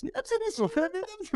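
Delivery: very high, slow squeaky announcement; comic falsetto show opener; inverse of deep voice. Pretend. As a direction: squeak out a stage greeting. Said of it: "Ça aurait été marrant que ce soit l'inverse".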